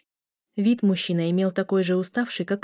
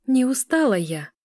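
higher-pitched mild protest; attempted objection; 6 semitones higher, 3 dB louder than usual. A narrator's reading. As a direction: try to object, voice lifted. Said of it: "попыталась возразить девушка".

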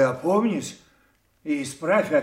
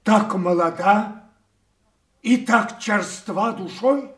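rebuking; 5 semitones higher, 5 dB louder than usual. Acted. King rebukes his daughter.

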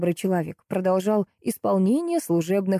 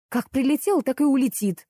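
higher-pitched cross retort; angered; 8 semitones higher than usual. A narrator's reading. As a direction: snap back, moderately angry. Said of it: "обозлилась я". (рассерженно)

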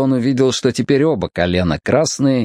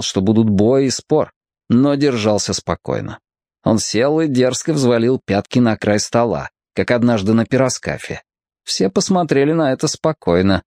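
ordinary delivery

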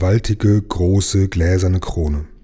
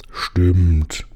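gurgle it like water in the throat; gurgled. Acted. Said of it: "gurgelte der Meerkönig".

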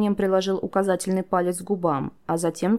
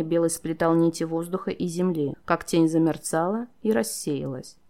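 plain reading voice

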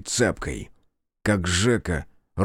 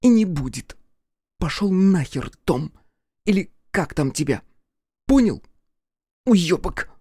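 furious cursing; 8 semitones higher than usual. Acted. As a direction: spit it at him. Sonka curses the amulet.

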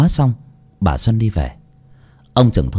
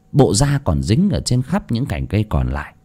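plain reading voice

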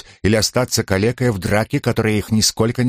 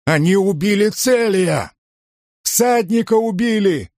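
very high, slow wailing cry; shrieking; 11 semitones higher than usual. A narrator's reading. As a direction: wail it out in shock. Patrician shrieking over the body.

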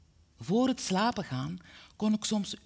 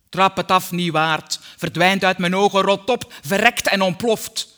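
loud, furious cursing; full-volume angry dismissal; angry father; 9 dB louder than usual. A narrator's reading. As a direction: hurl it out like an enraged father. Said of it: "liet hij zich soms ontvallen".

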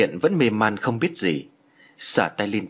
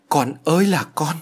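distraught wail; 5 semitones higher, 4 dB louder than usual. A narrator's reading. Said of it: "Bà giáo nhân thất thần".

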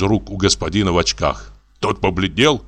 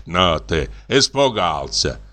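ordinary delivery, nothing marked

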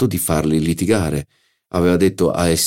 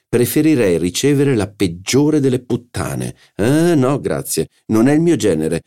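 indignant hostess; 6 semitones higher than usual. Acted. Madame Verdurin's indignant outburst.

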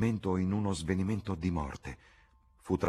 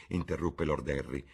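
plain reading voice

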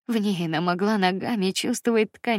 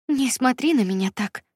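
breathless; 6 semitones higher, 4 dB louder than usual. A narrator's reading. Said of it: "прерывисто сказала она".

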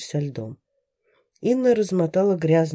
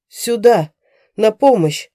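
louder desperate shout; shouting for help; 4 semitones higher, 8 dB louder, slower than usual. A narrator's reading. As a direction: call out for help from afar. Man calling for help.

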